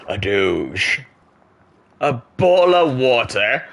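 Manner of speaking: a bo'l o' wa'er in a mock British accent, the t dropped and said slowly. Reads chat and hams up a British accent in slow mock fashion. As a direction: drop the t like a hammed-up Brit.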